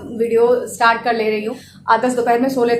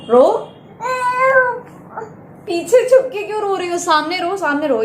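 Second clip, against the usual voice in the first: playful coaxing; 7 semitones higher than usual. Real, not acted. Teasing crying daughter on camera.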